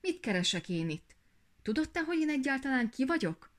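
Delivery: rising to a high, loud demand; indignant princess standing up for herself; emboldened, indignant. A narrator's reading. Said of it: "aztán felbátorodott". (felbátorodva, felháborodottan)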